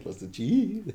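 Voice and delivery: mock voice breaking, squeaky and high; voice cracking. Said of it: "What's your voice?".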